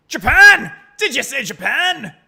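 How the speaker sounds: loud, high outburst in fake Scottish; Barry Caden. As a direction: explode in disbelief with a bad Scottish accent. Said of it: "I'm the governor, Barry Caden".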